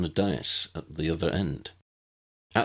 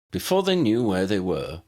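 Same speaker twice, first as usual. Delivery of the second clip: ordinary delivery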